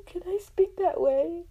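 high, loud, eager squeal; giddy high-pitched plea; excited wishing. Retold or imitated. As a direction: ask it bursting with eagerness. Gushing wish to imitate accents.

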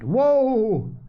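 high-pitched call to a horse. A narrator's reading. Mister Beebe stopping the old horse, calling out. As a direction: sing out to halt, pitch jumping up.

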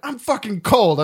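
high, slow mock complaint; angry retort. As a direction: imitate a mistress snapping back as she storms off. Mistress snapping back as she storms.

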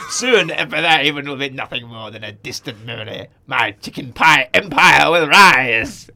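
villain gloating over his pie empire, evil grin; Emperor Palpatine. Acted. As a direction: relish the evil plan like a Sith lord. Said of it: "he's Emperor Palpatine".

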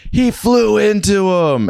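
very high, slow mock wail; hammed-up high-pitched accusation; imitated accusing driver. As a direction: mimic an outraged woman, drawn out high. Retold driver accusing him to police.

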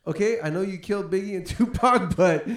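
loud, high, effusive retelling; hammed-up gushing thanks. Thanking Suge for saving him, loud high mock gushing. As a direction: gush with exaggerated relief and gratitude.

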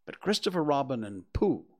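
ordinary delivery

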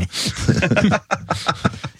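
breathy high laugh; airy laugh at his own story. Real, not acted.

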